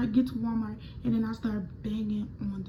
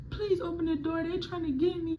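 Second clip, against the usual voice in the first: pleading; 7 semitones higher, 3 dB quieter than usual. Retold or imitated. Begging at the locked door.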